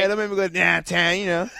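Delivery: loud laughing Pittsburgh "dahntahn"; Yinzer accent. Shown as a demonstration. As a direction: stretch "downtown" like a Yinzer. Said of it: "I got the downtown out of him".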